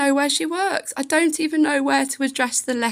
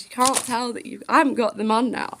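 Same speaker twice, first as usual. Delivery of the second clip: ordinary talk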